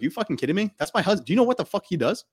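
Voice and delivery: mock outrage defending her husband; indignant wife. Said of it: "she'd be like".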